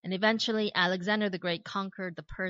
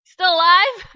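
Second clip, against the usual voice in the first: much higher, louder anxious shout; frantic king shout; 12 semitones higher, 15 dB louder, slower than usual. Retold or imitated. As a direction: yell the question into the den, half joking. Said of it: "If I were the king, I would just be like".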